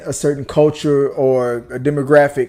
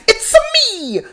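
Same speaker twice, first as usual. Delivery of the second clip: high-pitched mock Italian accent; cartoon Italian plumber squeal; Mario. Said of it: "That stereotypical".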